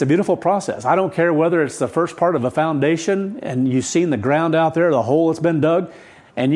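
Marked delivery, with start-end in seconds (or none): none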